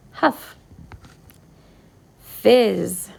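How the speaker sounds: ordinary talk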